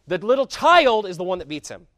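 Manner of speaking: put-on child punched loud with a rising tune; loud emphatic climax. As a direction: build up to the victory. Climax about David.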